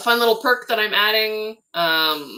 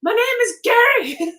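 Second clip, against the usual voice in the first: squeaky high, slow cartoon voice for the made-up creature; voice of Gary; 7 dB louder than usual. Creator voicing her toy creature, high and slow. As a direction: play the toy introducing himself, high and drawn out. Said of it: "he was like".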